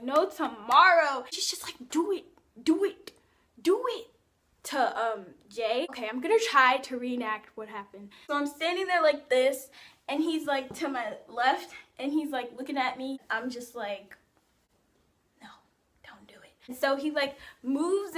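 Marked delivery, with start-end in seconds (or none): 1.9-4.1 high-pitched imitation of a pushy friend; egging on. Friend eggs her on. 15.4-16.5 barely audible whispered plea; hushed dread. Retold or imitated. Retold dread before a kiss.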